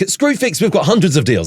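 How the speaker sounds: high, peppy advert voiceover; imitated store-advert pitch; voiceover person. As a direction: sell it like a radio ad presenter. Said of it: "the usual voiceover person".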